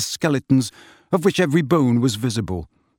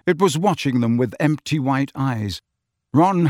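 ordinary delivery

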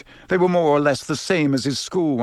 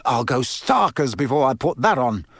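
ordinary delivery

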